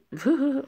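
high burst of laughter; a short, high-pitched laugh. Real, not acted. Reader laughing at the obedient-wife line, amused laugh.

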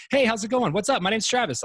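high, loud, pushy cheer; overbearing salesman greeting. Shown as a demonstration. Salesman at the door greeting a homeowner, overbearing and loud. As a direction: gush at the door like a too-eager salesman. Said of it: "when you talk loudly".

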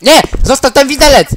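sudden panicked yell; shouting. Acted. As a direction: yell it in alarm. Panicked shout in game roleplay.

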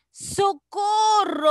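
high, loud, drawn-out cry for help; calling for help. Shown as a demonstration. Teacher acting out a frightened shout for the class. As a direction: shout it like you're in danger. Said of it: "La número dos dice".

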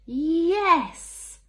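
high, loud delighted yes; excited yes. Acted. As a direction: cheer it out. Teacher's excited thought.

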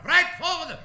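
loud, high military call; brisk army command. Acted. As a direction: call the next recruit in like an officer. Army doctor dismisses recruit.

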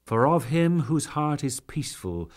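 ordinary delivery

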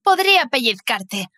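ordinary delivery, nothing marked

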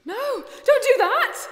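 high, loud desperate cry; frightened scream of protest; panicked shout. Acted. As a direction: yell to stop her at once. Panicked plea in the boat.

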